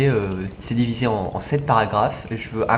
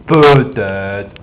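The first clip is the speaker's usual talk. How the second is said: "peut-être" louder and drawn out; « peut-être » traînant; 3 semitones lower, 7 dB louder than usual. Shown as a demonstration. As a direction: lean hard on a long "peut-être". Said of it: "c'est pas peut-être". (« peut-être » appuyé et étiré)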